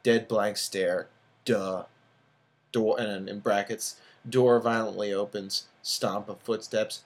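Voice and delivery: ordinary delivery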